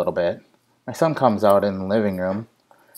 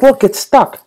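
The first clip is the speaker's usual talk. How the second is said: put-on high, louder little-kid voice; imitating son; 7 dB louder than usual. Dad quoting his toddler pointing down, high kid voice. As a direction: whine it like a toddler. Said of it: "He's like".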